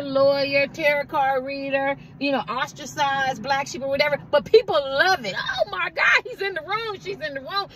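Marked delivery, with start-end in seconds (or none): 5.4-7.7 high squeal of delight, fading off; mock-thrilled "oh my God" at a crowd; excited gasp. Friends gushing at an arrival, high excited squeal. People thrilled you walked in.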